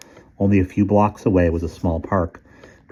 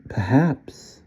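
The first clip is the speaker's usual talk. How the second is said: put-on high, smooth sly old gentleman; sly old man voice; 6 semitones higher than usual. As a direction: purr it like a tempter.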